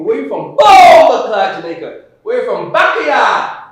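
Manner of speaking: booming, high-pitched Jamaican boast; proud Jamaican. Acted. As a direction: shout your homeland with swaggering pride. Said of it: "Jamaican people were proud people".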